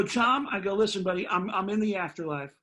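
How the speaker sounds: loud, high ranting comedian voice; Sam Kinison. Retold or imitated. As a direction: rant it like a wild stand-up legend. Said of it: "that's a good impression".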